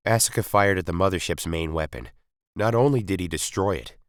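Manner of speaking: ordinary delivery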